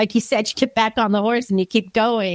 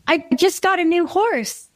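put-on higher-pitched little kid voice; five-year-old niece; 6 semitones higher than usual. Niece telling her relative about her evening, little kid voice. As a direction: lightly imitate a small child sharing news. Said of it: "little niece. She's, she just turned five".

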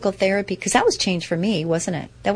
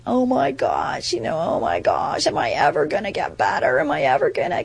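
mock despair; 4 semitones lower than usual. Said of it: "I had the choice of going".

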